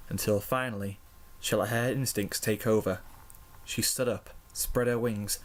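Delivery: ordinary delivery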